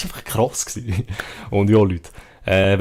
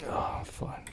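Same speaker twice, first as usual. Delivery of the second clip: whisper; 7 semitones higher, 5 dB quieter, slower than usual. Acted. Whispering while drinking in game.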